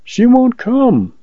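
high, slow plaintive woman's voice. A narrator's reading. Woman objects to fetching her mother, plaintive. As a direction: draw it out high as the unhappy woman.

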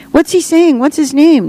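put-on high-pitched, insistent kids' questions; eager children. A narrator's reading. Children questioning her about the dove, high and eager.

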